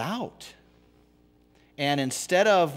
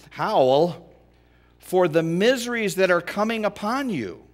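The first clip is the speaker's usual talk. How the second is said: loud proclaiming; 8 semitones higher, 6 dB louder than usual. Acted. Dramatic scripture reading.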